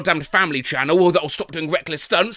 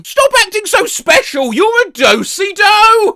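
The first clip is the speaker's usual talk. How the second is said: put-on child's shout; 8 dB louder than usual. Child insulting parent, voiced.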